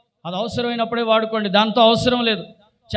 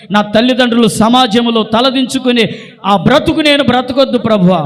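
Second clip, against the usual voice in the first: model prayer; 5 dB louder than usual. Shown as a demonstration. Preacher prays as a daughter not to shame her parents, building fervently. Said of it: "ప్రార్థన చేసుకోండి".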